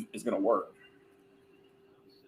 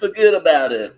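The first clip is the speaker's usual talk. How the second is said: loud, higher mock New York accent; 6 semitones higher, 11 dB louder than usual. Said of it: "my, uh, New York accent".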